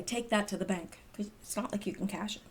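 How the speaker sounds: soft, fast, half-whispered aside; muttered aside. Real, not acted. Vlogger muttering a joke to herself, whispered. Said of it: "aside muttered to herself".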